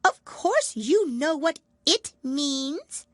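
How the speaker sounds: high, loud, cross mouse voice; rather cross. A narrator's reading. Mouse answers the duck, rather crossly. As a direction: snap it back impatiently. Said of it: "the mouse replied rather crossly".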